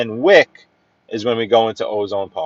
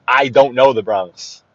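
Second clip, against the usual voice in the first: usual speaking voice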